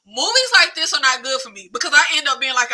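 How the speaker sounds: ordinary talk, nothing marked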